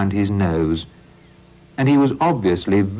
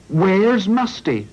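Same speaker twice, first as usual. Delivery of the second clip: higher, slow alarmed call; worried cry; 12 semitones higher, slower than usual. Acted. Farmer crying out after the crash, alarmed and slow. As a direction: call out in sudden worry. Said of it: "cried Farmer Oatfield".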